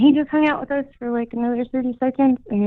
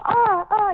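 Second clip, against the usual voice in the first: two high bird squawks; playful seagull cries; imitated bird calls; 11 semitones higher than usual. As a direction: call back and forth like a gull. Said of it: "the bird and I are".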